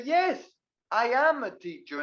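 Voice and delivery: high, insistent "Yes, I am", uncontracted; emphatic stress on AM. Shown as a demonstration. Teacher rebutting a doubter, emphatic stress on "am". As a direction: punch "am" as a separate word to insist. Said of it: "I separate it for emphasis".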